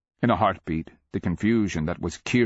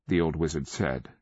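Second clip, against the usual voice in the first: plain reading voice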